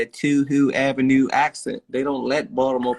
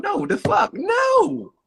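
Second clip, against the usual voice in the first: very high-pitched cry of disbelief; exasperated outburst; much slower than usual. Acted. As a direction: squeal it out in outrage, voice shooting right up. Outraged answer to the mimicked talk.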